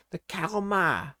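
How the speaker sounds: normal talking voice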